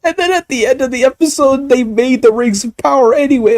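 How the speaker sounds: high, fast exasperated rant; can't believe it, voice way up. Real, not acted.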